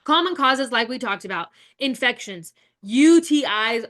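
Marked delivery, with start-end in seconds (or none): 2.8-3.8 U T Is slow, each letter louder and drawn out; stress on U T Is. Shown as a demonstration. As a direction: spell out U T Is slowly and loudly. Teacher stressing key cause.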